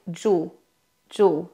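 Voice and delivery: jo said alone as English "joo"; isolated first sound. Shown as a demonstration. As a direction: give jo as a separate syllable, then repeat. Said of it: "on divise les sons".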